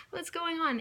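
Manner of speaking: high, baffled little question; bewildered. Retold or imitated. To quarrelling moms, high baffled question. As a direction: ask it high and lost. Bewildered mum amid the fight.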